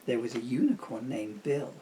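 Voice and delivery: soft storybook read-aloud; soft rhyming bedtime reading; reading to children. Acted. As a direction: lull them into the story gently. Reads rhyming picture book aloud.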